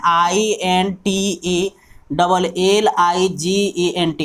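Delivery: very slow letter by letter; teacher spelling intelligent. Shown as a demonstration. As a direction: spell each letter deliberately.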